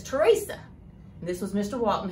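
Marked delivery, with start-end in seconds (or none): 0.0-0.6 sharp, raised call of her name, pitch jumping high; an upset boss calling out her name from the doorway. Retold or imitated. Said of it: "and he is highly upset".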